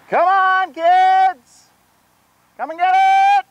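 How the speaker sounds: high, loud yelling call across the field; calling out to goats. Real, not acted. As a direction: holler to call the herd in. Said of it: "I'm gonna yell out".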